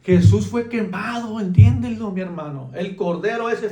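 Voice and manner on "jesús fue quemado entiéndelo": fervent preaching. Real, not acted. Preaching climax.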